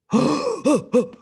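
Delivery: loud, high mock gasp; shocked gasp. Teacher reacts to borrowing without giving back, shocked gasping.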